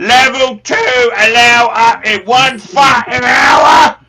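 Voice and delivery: loud ranting Cockney trader voice, swearing at the clients; shouting Cockney character. Acted. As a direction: bellow the price list in a Cockney accent. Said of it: "carried away there with the character".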